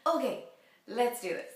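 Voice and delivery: loud, high pep talk to herself; Okay, let's go energy; psyched up. Real, not acted. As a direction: rev yourself up to start.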